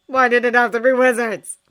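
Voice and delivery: loud, despairing wail; exasperated lament. A narrator's reading. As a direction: throw up your hands and cry it out.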